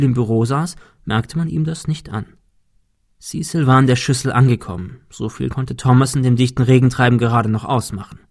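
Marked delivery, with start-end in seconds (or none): none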